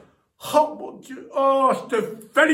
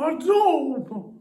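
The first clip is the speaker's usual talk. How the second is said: normal talking voice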